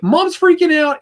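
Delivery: put-on panicked girl. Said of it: "she's like".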